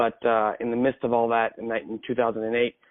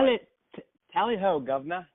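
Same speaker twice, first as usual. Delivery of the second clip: put-on a jokey, overdone upper-class English accent, higher at the start; hammed-up English accent; 7 semitones higher than usual. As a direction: play a stage Englishman with a broad English accent. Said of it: "big good English accent back to me".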